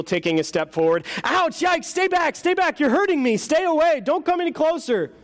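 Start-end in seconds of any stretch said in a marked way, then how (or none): 1.2-5.1 high, panicked squeals of pain; frightened ghost. Acted. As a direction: shrink back from the angel in fear. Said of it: "said the ghost".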